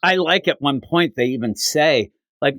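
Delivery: normal talking voice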